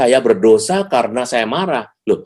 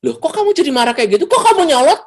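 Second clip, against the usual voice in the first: high, rising indignant scolding; mother snapping back; 5 dB louder than usual. Acted. Mother answering her defiant child, snapping back indignantly. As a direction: scold in a much higher voice, getting louder. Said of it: "Wah, mamanya nyolot lagi".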